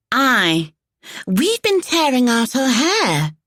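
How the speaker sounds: high, slow, brash woman's voice. A narrator's reading. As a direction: drawl it out boldly. Thais speaks to Jack.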